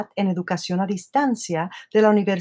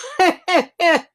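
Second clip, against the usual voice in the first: laugh; 10 semitones higher, 4 dB louder than usual. Real, not acted. Moved laughing about late mother.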